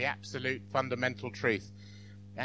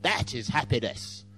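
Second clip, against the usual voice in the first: hammed-up romantic Frenchman; mock French accent; 2 semitones lower than usual. Joke's Frenchman defining happiness, hammed-up French accent. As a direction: play a stage Frenchman relishing each phrase. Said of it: "And the Frenchman says".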